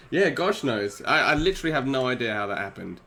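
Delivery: ordinary talk, nothing marked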